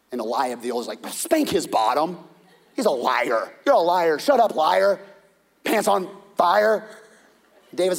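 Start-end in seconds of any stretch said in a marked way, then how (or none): 1.1-6.9 put-on loud childish taunt; taunting older brother. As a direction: jeer at the little brother. Older brother mocking David.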